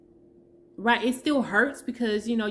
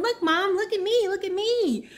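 put-on much higher, louder eager child's call; little kid calling mom; 11 semitones higher, 5 dB louder than usual. A child calling for mom's attention while playing, eager and high. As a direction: play a kid begging mom to watch. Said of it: "those little kids and you're playing around".

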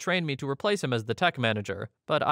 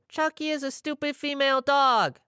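put-on much higher, childish taunting yell; toddler yelling; 12 semitones higher than usual. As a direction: squeal it like a bratty toddler. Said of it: "followed me around the store yelling".

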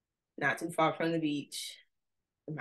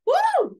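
very high, loud whoop; celebratory woo; 9 dB louder than usual. Shown as a demonstration. Speaker whooping as she shows how to say the title. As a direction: whoop like you're dancing. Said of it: "with a little dance".

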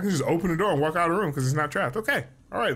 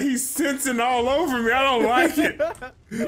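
laughing mock complaint; 6 semitones higher, slower than usual. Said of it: "I was like".

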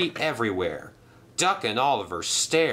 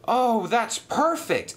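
excited exclaim; 13 semitones higher, slower than usual. Acted. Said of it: "exclaimed Thomas".

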